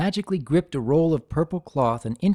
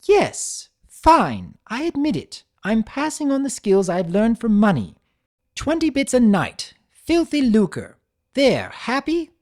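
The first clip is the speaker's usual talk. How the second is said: snappish and cornered, pitched high; sharp, defensive; 10 semitones higher, 6 dB louder than usual. A narrator's reading. Rarity snapping at Twilight, sharp and defensive. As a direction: bristle and snap at her friend. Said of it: "she asked sharply".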